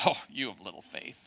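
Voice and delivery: low, soft, disappointed scolding voice; mock scolding. Preacher mocks a scolding Jesus, low and disappointed. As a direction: sigh out a scolding rebuke. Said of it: "as a scolding".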